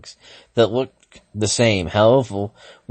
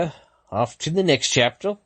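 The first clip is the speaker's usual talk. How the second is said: usual speaking voice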